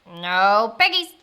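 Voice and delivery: loud, slow duck voice; stubborn duck's answer; quacking duck. A narrator's reading. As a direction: quack the words out, drawn out. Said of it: "quacked the duck".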